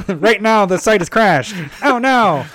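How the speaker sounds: high, loud excited news reporter; nerdy reporter voice. Pretend. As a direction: report live like a nerdy newscaster. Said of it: "a nerdy voice all of a sudden".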